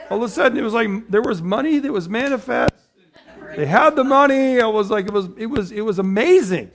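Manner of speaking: giddy laughing delight. Real, not acted. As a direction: laugh through the memory. Laughing about parents' dishwasher.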